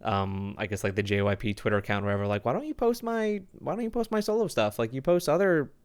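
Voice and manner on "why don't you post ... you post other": higher-pitched mock complaint; imitated call-out. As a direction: quote an indignant complaint in a higher voice. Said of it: "straight up called out".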